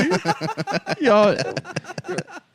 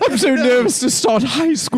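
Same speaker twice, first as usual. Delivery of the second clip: nervous British pupil, pitched up; mock British accent; 6 semitones higher than usual. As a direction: play at being a jittery Brit. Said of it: "Nice accent".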